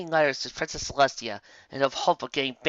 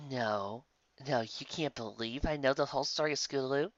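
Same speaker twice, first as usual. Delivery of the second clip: quiet, awkward apology; sheepish Lyra, softer voice; 4 dB quieter than usual. Acted. Lyra awkwardly apologizing to Twilight after the wedding. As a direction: mumble sorry, embarrassed.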